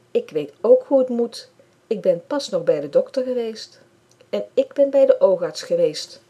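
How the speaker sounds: put-on a child yelling back in an argument; shouting. A narrator's reading. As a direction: Lisanne shouts at Bram during their quarrel, loud. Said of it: "roept Lisanne".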